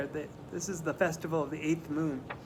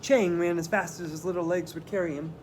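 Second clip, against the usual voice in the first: ordinary delivery, nothing marked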